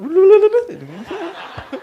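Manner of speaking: high loud burst of laughter. Real, not acted. Laughing at own joke. (tertawa lepas)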